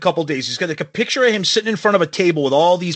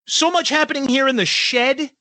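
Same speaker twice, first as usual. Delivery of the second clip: high, mock-cheery read-out; mocking quote; 7 semitones higher than usual. Host re-reading a rock star's out-of-touch tweet, mocking. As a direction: parrot it back in a higher, sarcastic lilt.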